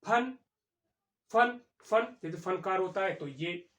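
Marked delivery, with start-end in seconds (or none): 0.1-2.2 फन with a clear lip-teeth f, repeated and louder; emphatic f sound. Shown as a demonstration. Teacher drills the class on the Persian f in फन. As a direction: drill फन with a crisp f, stressing it each time. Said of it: "हमने कहा".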